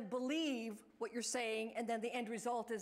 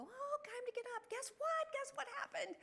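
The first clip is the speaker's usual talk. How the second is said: much higher, sing-song and bright; cheery wake-up call. Retold or imitated. Staff waking a resident in her wet bed, high excited sing-song. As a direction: chirp it like a big surprise.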